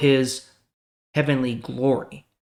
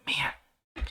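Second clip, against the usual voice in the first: frustrated Jesus; 3 dB quieter than usual. Retold or imitated. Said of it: "he probably felt very frustrated".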